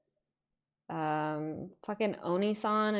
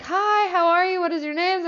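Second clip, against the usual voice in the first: high, loud, upbeat imitation; perky host greeting; 10 semitones higher, 8 dB louder than usual. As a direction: imitate a streamer greeting excited callers. Imitating streamer greeting callers.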